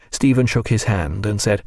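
ordinary delivery, nothing marked

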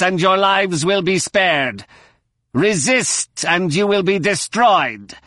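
high, slow commanding alien broadcast; booming alien voice. Acted. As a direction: order them down like an alien conqueror. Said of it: "the now familiar alien voice".

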